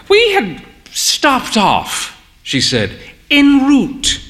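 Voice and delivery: put-on a proud old dear confessing lightly; genteel old mother. A narrator's reading. Mother telling their story.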